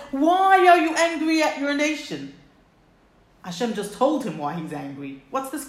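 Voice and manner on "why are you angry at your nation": louder, higher pleading why; pleading question. Acted. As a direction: plead it with raised voice. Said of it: "Moshe says".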